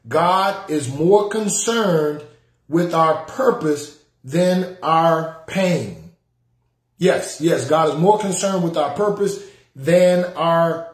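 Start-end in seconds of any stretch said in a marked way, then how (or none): 0.1-6.1 slow, with a pause before the second half; slow emphasis. Shown as a demonstration. Preacher stressing the third life principle, slowly. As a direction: let it sink in word by word. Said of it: "That's the third life principle".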